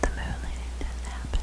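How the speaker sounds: whispered jokey aside, barely audible; conspiratorial whisper. Pretend. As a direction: whisper it like a forbidden secret.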